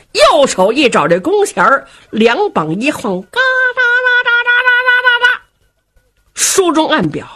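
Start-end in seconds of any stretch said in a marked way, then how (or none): 3.2-5.4 very high, fast creaking bowstring noise. Acted. Storyteller imitating the bow being drawn, high fast creaking. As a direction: make the bow creak as it bends.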